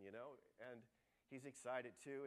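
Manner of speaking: normal talking voice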